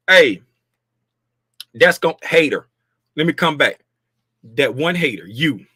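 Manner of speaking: ordinary talk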